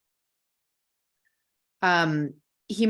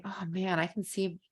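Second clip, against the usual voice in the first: much softer, gentle and understanding; soft empathetic husband; 7 dB quieter than usual. Pretend. Husband soothing his upset wife, soft and gentle. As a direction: ease into it softly, like you truly get her. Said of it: "if he would say".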